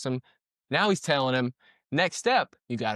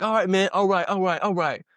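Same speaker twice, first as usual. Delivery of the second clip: a louder, higher half-hearted giving in; mock surrender; 4 semitones higher, 4 dB louder than usual. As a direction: play the tough guy suddenly backing down, a bit hammed-up. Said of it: "And then the guy's like".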